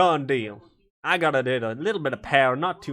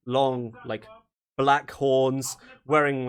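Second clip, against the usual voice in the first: ordinary delivery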